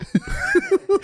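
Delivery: shrieking high laugh; high burst of laughter. Real, not acted. Friends crack up as he recalls the push threat, high laugh.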